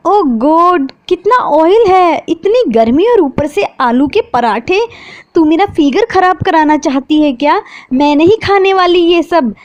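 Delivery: high, fussy, whiny complaint; a pampered girl turning up her nose at the food; spoiled sister. A narrator's reading. Jiya complains to her sister about oily parathas, whiny.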